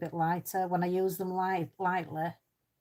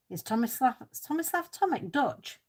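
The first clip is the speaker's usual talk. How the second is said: surprised disbelief; 6 semitones higher than usual. Real, not acted. Learns surprising fact from chat.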